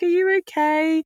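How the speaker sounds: a caring doctor, lightly voiced; imitating concerned doctor. Doctor's concerned question retold.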